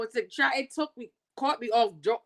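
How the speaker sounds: ordinary talk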